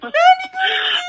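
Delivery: high, loud, tearful shout of joy; crying happy shout. Retold or imitated. Telling her husband the engagement news through happy crying. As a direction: sob out the news in happy tears. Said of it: "I started crying".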